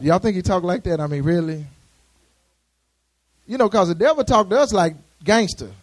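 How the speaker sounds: usual speaking voice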